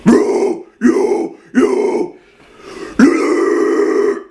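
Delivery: loud airy growled yos; repeated low false chord screams. Shown as a demonstration. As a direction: chain low guttural screams. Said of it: "But lows are".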